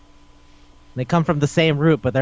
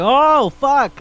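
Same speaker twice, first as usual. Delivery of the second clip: high, louder "oh no" reaction; acted shock; 10 semitones higher, 5 dB louder than usual. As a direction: re-enact a stunned viewer's outburst. Acts out own shocked reaction.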